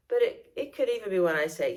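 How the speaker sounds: normal talking voice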